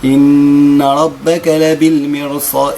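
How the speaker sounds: slow, melodic tajwid chanting; Quran recitation. Shown as a demonstration. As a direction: chant the verse slowly. Recites the verse. (ترتيل)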